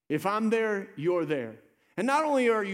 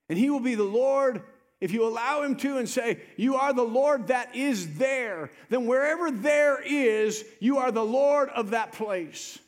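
impassioned preaching; 4 semitones higher, 3 dB louder than usual. Real, not acted. Preacher telling the congregation God is already there, impassioned preaching. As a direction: preach it up, leaning on each phrase.

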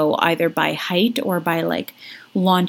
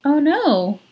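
high mock oh no; 8 semitones higher than usual. Shown as a demonstration. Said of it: "That's not my character voice".

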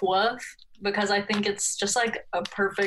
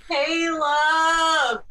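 loud shout across the house; 9 semitones higher, 6 dB louder than usual. Real, not acted. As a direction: yell the name to another room. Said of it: "me shouting that".

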